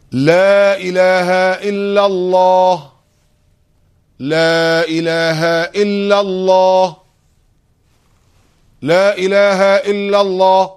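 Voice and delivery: very slow, high and loud, held like a chant; loud drawn-out declaration. Shown as a demonstration. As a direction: call it out long without moving the lips. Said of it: "بقولها بصوت عالي أوي". (نطق عالٍ وممدود دون تحريك الشفتين)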